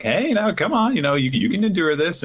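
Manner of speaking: put-on mother coaxes hungry child, high and gentle; coaxing mother. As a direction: coax her in a high sweet voice. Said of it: "Carmel's like".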